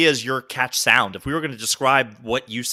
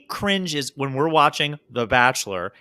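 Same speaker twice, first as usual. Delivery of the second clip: ordinary talk, nothing marked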